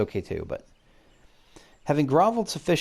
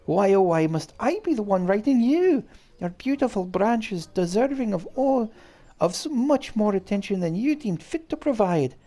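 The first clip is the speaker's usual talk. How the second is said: much higher, hammed-up Scottish accent; bad Scottish accent; 9 semitones higher than usual. Fairy King scolding the player in a high, hammy Scottish accent. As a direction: wail the complaint in an overdone Scottish brogue. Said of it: "the worst Scottish accent in history".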